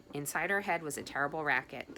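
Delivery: ordinary delivery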